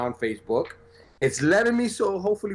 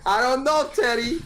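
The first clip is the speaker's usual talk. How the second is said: laughing; 10 semitones higher than usual. Real, not acted. Laughing at the news.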